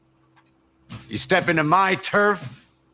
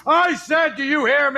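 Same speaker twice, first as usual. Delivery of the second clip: drill sergeant yell; 6 semitones higher than usual. Acted. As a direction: bark it the second time. Sergeant repeats the question louder.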